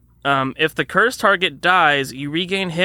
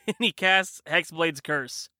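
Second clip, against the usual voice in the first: ordinary talk, nothing marked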